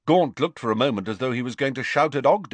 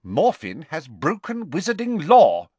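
measured, stern pronouncement, pitched up; 6 semitones higher, 5 dB louder than usual. Acted. Official accusing the son before his father, stern. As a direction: state the offence with stern authority. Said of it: "said Ogden sternly".

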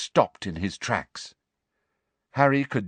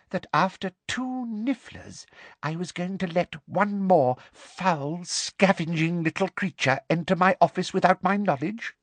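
menacing headmistress; 7 semitones higher than usual. A narrator's reading. Umbridge hisses threat.

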